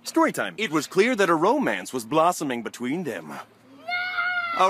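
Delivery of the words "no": shrieking no. Acted. Sudden high shout.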